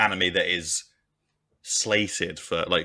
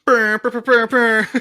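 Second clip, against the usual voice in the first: soundboard buzz; 11 dB louder than usual. Pretend. Playing with a soundboard effect.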